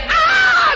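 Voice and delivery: sudden high cry of pain; high pained scream. Acted. Head nurse screaming as the boil is lanced, high scream. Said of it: "dia teriak".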